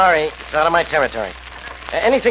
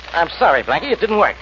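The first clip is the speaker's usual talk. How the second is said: ordinary talk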